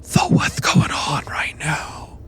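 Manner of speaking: breathy hushed whisper of a worried dog; hushed companion whisper. Acted. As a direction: keep it a secret whisper in the dark. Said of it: "he just kinda whispers and goes".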